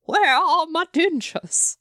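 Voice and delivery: high, slow would-be British granny that lands Southern; botched British old lady. Acted. Host takes up the dentures bit, high Southern-sounding British granny. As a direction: attempt British, slip into a Southern drawl. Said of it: "That was Southern".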